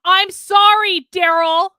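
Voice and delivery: loud, slow, exasperated cut-in; loud interruption. Real, not acted. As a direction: break in loudly and stretch it out. Heated podcast argument, loud interruption.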